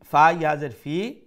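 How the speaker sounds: normal talking voice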